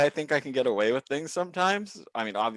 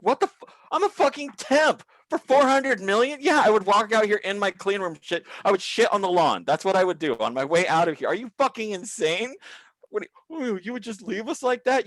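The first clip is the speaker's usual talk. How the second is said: outraged rant; 9 semitones higher, 4 dB louder than usual. Retold or imitated. Outraged reply to manager.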